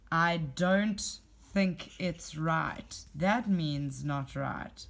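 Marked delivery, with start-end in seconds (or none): 0.1-3.0 very slow, deliberate reading; slow quote. Shown as a demonstration. Teacher quoting the line to pupils, very slow. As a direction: read it out word by word.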